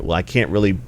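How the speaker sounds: ordinary delivery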